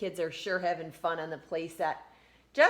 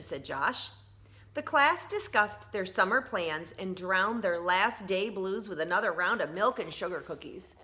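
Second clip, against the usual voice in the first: usual speaking voice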